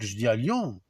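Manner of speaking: normal talking voice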